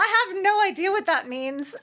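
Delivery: laughing, high and bright through the words; laughing retort. Retold or imitated. Mom laughing as she retells answering her son in the car. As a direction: laugh it out, pitch way up. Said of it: "I said".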